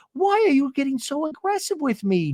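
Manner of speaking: high-pitched mock protest; retold wounded challenge. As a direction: imitate an aggrieved friend. Acted quarrel question.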